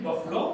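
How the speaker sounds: बफलो said short as buf-lo; correct pronunciation. Shown as a demonstration. Teacher repeating the correct बफलो for the class. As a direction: clip बफलो to two quick syllables, buf-lo. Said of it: "अंग्रेजी को अंग्रेजी तरह बोलना".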